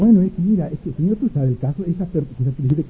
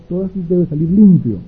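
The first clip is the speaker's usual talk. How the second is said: usual speaking voice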